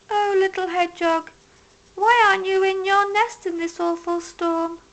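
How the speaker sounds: a doe fussing over the hedgehog; concerned mother deer. A narrator's reading. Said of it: "she asked".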